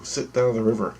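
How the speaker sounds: ordinary talk, nothing marked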